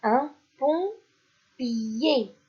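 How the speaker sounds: "Un pompier" very slow, every syllable stretched; slow dictation. Shown as a demonstration. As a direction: dictate "Un pompier" slowly to children. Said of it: "Deuxième ligne maintenant".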